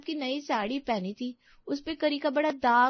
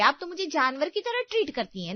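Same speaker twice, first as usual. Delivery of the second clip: ordinary talk, nothing marked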